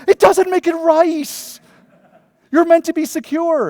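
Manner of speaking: high, loud, slow exasperated protest; hammed-up outrage at the agent; indignant outburst. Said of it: "And I said".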